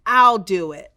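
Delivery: loud, confident declaration. Acted. Boy to his team in the huddle, loud and confident. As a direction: step up and claim the shot. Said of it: "Feeling more confident than ever".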